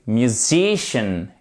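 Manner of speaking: "Musician" slow, stress on the "si" syllable. Shown as a demonstration. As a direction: stretch "Musician" and land on its middle. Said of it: "va être accentué". («Musician» lent, accent sur «si»)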